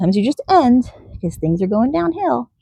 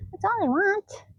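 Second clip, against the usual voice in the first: ordinary delivery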